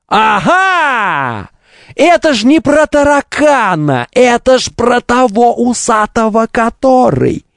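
high, eager "aha" voice; gleefully pouncing on the hidden meaning; excited discovery. Retold or imitated. Imitating viewer spotting the hint.

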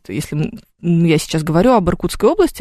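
usual speaking voice

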